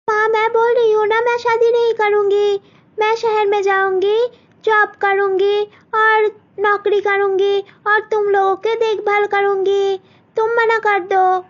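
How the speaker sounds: high, fast, protesting daughter; insistent young girl. Acted. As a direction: rush it out, refusing firmly.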